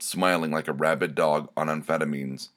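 ordinary delivery, nothing marked

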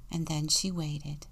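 ordinary delivery

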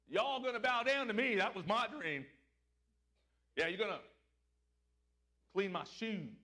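loud, cocky boasting. Acted. Joseph lording his dream over his brothers, cocky. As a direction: brag it out loudly like a cocky kid. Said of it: "he was kinda cocky".

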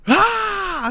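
high yelled "Ah!"; imitating a drunk friend's scream; retold scream. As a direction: let out a crazy scream. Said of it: "starts screaming like fucking crazy".

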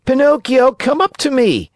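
high-pitched, loud calling out; shout. A narrator's reading. Harlequin calls Pinocchio onto the stage, shouting. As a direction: call him up in a high excited voice. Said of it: "shouted Harlequin".

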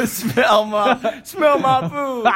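shouting the punchline through laughter; laughing shout. Real, not acted. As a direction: yell it while cracking up.

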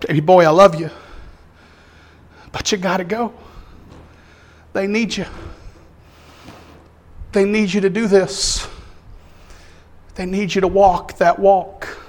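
a father's quiet heartbroken reassurance; tender father. Acted. The Father tells his son he must go, tenderly. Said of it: "his father looked at him and said".

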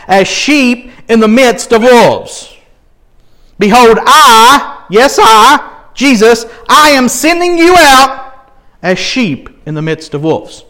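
preaching at full force; forceful preaching. Acted. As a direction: drive the verse home.

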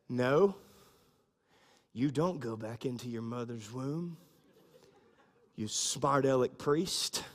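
a hint of a put-down to the priest, slowing at the end; mock rebuke. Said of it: "he doesn't say".

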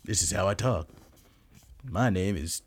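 a hint of a tough-guy impression; imitating a thug. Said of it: "That's what he says".

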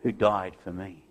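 hushed, low retelling of a grieving woman; soft, understated sorrow; tearful reply. Retold or imitated. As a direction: quietly voice her grief, slightly held back. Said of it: "With tears on her cheek".

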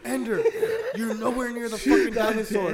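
put-on loud, high, laughing shout at the kid; laughing yell. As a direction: yell across the park while laughing. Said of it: "I was the only one screaming".

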